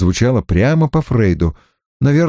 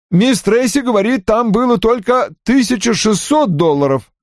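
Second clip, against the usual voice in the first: much higher-pitched explanation; subordinate explaining; 11 semitones higher than usual. Acted. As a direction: explain it in a high voice. Ann's objection quoted by reporting Johnny. (высоким голосом, объясняя)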